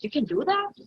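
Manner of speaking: incredulous rising question; amazed question. Retold or imitated. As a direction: imitate a surprised listener. Retold amazed listeners questioning her.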